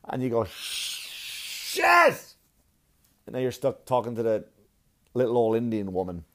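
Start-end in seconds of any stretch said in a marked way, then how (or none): 0.4-2.3 long hissed build into a high cry; frustrated curse. Acted. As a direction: drag out the hiss, then burst. Acted frustration at losing teller math.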